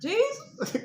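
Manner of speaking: high, loud, scared little question; high startled call to Jesus. Retold or imitated. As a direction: squeak it out in comic fright. Startled under covers.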